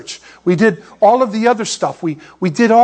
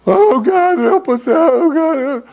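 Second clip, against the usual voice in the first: whining prayer; 8 semitones higher, slower than usual. Retold or imitated. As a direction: wail it like a self-pitying prisoner. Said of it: "That's what we'd have been doing".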